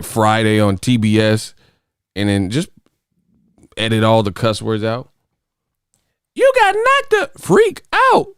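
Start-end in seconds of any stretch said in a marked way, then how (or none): 6.3-8.3 very high, slow, squealing movie voice; mocking the censored TV version; Chris Tucker impression. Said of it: "that is not what Chris Tucker said".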